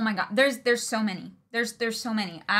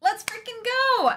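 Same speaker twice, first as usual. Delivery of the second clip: excited cheer; 12 semitones higher than usual. Real, not acted. Celebrating subscriber milestone.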